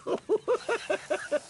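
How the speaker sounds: real laugh. Real, not acted.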